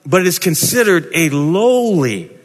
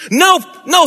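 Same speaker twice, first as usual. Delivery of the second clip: an indignant disciple pushing back; Peter's high alarmed refusal; Peter refusing; 11 semitones higher than usual. Acted.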